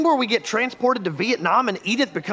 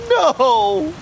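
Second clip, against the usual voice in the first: anguished shout; 6 semitones higher, 5 dB louder than usual. Acted. Friend sees him fall.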